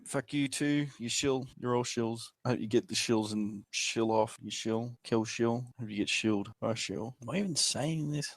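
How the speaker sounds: ordinary delivery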